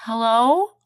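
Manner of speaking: loud rising call into silence. A narrator's reading. Lost in the forest, calling for the wolf, loud. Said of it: "I called".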